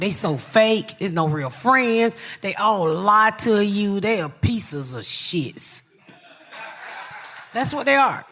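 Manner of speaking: ordinary talk, nothing marked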